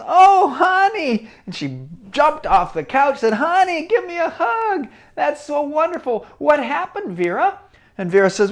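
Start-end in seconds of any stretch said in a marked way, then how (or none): 0.0-1.3 high, loud, slow delighted gush; overjoyed mother. Acted. Mother thrilled at her daughter, high loud and slow. 3.3-7.6 high, happy mom voice; delighted mother. Acted. Mother calling her daughter for a hug, high and happy.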